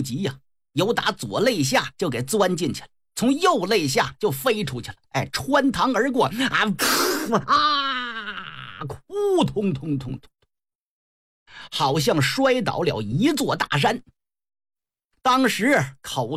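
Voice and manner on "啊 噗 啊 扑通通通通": imitated death and fall. Storyteller imitates a pierced general collapsing, burst, wail and thuds. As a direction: act out a hit, a dying cry and a crash.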